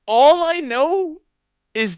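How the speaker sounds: high, loud and slow in disbelief; telling chat the story, high and loud in disbelief; incredulous. Real, not acted. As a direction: stretch it out, still amazed. Disbelief at pole dancer question.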